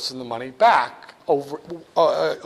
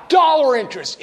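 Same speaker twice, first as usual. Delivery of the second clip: emphatic; 8 semitones higher, 5 dB louder than usual. Real, not acted. Teacher calls out term.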